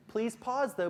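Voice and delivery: ordinary talk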